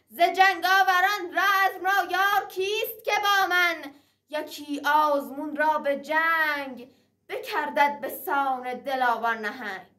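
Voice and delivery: high ringing heroic declamation; epic teahouse storyteller. Acted. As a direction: proclaim the challenge like thunder. Gordafarid's battle cry in verse. (نقالی حماسی پرشور و بلند)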